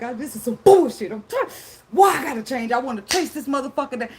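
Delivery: high, loud, exasperated outburst; frustrated cry-rant. Retold or imitated. Mimicking herself fed up with being the bigger person, loud and high. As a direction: vent like you're at the end of your rope. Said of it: "I might cry like".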